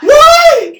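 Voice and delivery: very high, loud scream; exasperated yell. Real, not acted. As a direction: shriek back at someone calling you. Yelling back at repeated calls.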